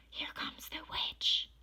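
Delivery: frightened whisper. A narrator's reading. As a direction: whisper in dread. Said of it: "they whispered".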